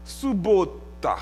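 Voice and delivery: subota said slowly, the Russian way; Russian word. Shown as a demonstration. Preacher teaching the Russian word, slowly. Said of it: "en russe".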